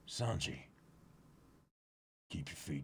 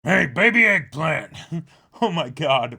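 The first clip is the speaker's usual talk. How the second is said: loud, higher, teasing call; 7 semitones higher, 13 dB louder than usual. Acted. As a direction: taunt the kid playfully. Mocking chef calls boy.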